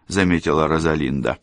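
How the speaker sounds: ordinary delivery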